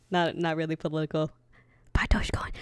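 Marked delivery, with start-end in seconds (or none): 1.9-2.5 whispered aside. Acted. Joking aside to audience.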